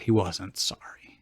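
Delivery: unvoiced whisper of a snarky aside. Real, not acted. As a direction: whisper it like a secret. Aside mocking the lie.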